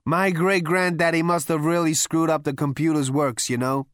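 high, loud, brash Zaphod; boastful high party voice. A narrator's reading.

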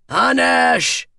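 high, ringing command to the crew; big theatrical captain's shout; booming call. A narrator's reading. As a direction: boom it out like a captain. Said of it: "boomed Alucard".

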